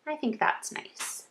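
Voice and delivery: unvoiced whisper; softly whispered approval; whispered aside. Real, not acted. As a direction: whisper it to yourself. Aside to herself.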